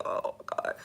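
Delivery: soft exasperated whisper; whispered oh God. Real, not acted. Bank worker whispers her dread at a crying caller. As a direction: breathe it out, done with it all. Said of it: "her weary reaction to callers".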